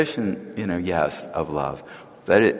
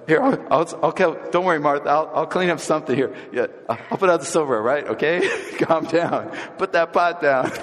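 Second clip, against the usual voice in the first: nervous placating; 6 semitones higher than usual. Acted. Calming an upset Martha.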